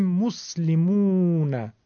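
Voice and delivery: slow مسلمون with "muu" held long; muslimuuna, stretch the "oo" of مسلمون; long waw. Shown as a demonstration. Teacher showing only length differs, drawn "muu" in مسلمون.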